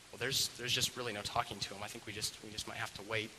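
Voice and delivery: soft, low, defeated report; subdued, halting voice; quiet resignation. Acted. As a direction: admit there's no talking to him. Mom gives up, tells family.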